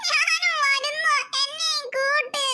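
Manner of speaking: put-on crying child. Little sister sobbing, begging to be taken along. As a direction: drag the words out through tears. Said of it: "പിന്നെ നിനക്ക് കരയുന്നത്?".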